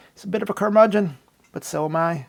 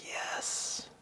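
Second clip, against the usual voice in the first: breathless whisper of revival; a faint whisper back from the dead; whispered relief; 20 dB quieter, slower than usual. Pretend. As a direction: gasp it out, barely alive. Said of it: "boomer audiophiles were like".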